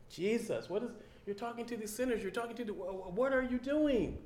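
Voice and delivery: high, fast, flustered protest; hammed-up outrage; scandalized townsfolk. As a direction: stammer it out in shock. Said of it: "said to the people".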